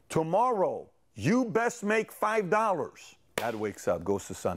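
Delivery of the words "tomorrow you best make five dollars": high, slow emphatic ultimatum; warning father. Acted. Dad orders son to earn it tomorrow, emphatic warning. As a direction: lay down the law, stretching each word.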